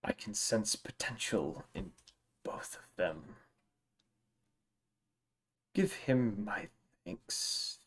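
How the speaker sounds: slow, whispered dragon voice. Acted. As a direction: breathe it out like an ancient dragon.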